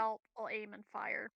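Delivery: ordinary talk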